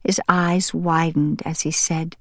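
ordinary delivery

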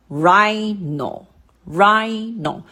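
rhino in two beats, RHI high; drawn-out rhino demo, first syllable lifted; stress on first syllable. Shown as a demonstration. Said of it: "哪个音节的音调比较高呢".

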